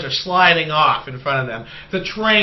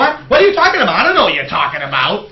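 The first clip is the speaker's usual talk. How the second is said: baffled mock pilot; 4 dB louder, faster than usual. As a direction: play baffled and clueless. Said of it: "and the guy goes".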